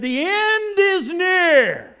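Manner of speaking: high, slow, loud proclamation; proclaiming sign. Retold or imitated. Priest's sign read out, loud slow proclamation. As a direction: boom it out like a street prophet. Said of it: "One sign said".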